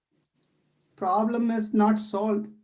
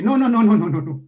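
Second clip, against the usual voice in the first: loud, quick string of no; rushed, louder protest; hasty denial; 3 semitones higher, 7 dB louder, faster than usual. Acted. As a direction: cut him off with a burst of noes.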